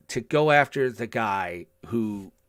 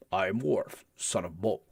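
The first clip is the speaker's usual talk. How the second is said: deep Worf voice; 5 semitones lower, 7 dB quieter than usual. Retold or imitated. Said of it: "he had this deeper timbre".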